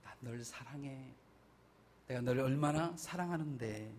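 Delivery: hushed, soft voice of God; whisper-close reassurance of love; tender love. Retold or imitated. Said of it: "쓰다듬으시면서".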